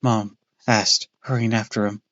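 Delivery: ordinary delivery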